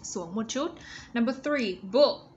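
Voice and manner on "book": BOOK with the final K released clearly; higher, louder model word "book"; crisp ending sound. Shown as a demonstration. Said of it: "Standing sound".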